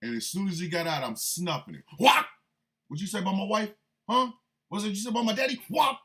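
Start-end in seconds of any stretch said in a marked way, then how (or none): 2.0-6.0 loud, higher, indignant challenge; hammed-up street confrontation; mock confrontation. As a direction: get in his face about your wife. Confronting Trump over wife.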